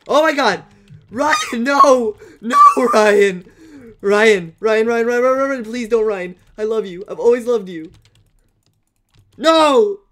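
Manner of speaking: loud, high pleading screams; panicked shouting. Real, not acted. Gamer begging Ryan not to kill him, panicked shouting. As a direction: scream and beg for mercy.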